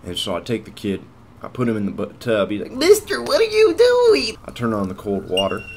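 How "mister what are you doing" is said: put-on very high, loud, drawn-out kid voice; panicked little kid. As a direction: squeal it loudly like a kid. Imitates panicked kid in fire story.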